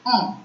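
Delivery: "on" as one nasal vowel, n not fully sounded; nasal French vowel. Shown as a demonstration. Teacher shows the sound of E N, nasal "on". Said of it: "E N is the nasal sound".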